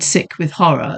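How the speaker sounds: usual speaking voice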